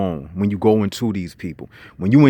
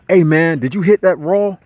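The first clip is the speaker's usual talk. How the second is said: higher, louder mock buddy question; pitched-up, eager slang question; high school buddy; 6 semitones higher, 5 dB louder than usual. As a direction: ask it like one guy hollering to another. Acting out high school slang talk.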